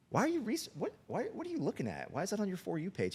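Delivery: retold disbelief, voice leaping high; alarmed parent grilling his kid; flustered dad. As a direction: stumble over high, incredulous questions. Alarmed father questioning his son, retold.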